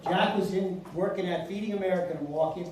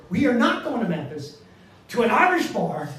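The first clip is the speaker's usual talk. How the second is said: loud, higher, insistent protest; emphatic refusal; 5 semitones higher, 7 dB louder than usual. Retold or imitated. Son overruling dad's Irish bar plan, loudly insistent. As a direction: put your foot down, loudly.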